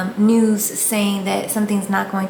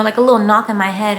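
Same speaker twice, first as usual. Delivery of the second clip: ordinary delivery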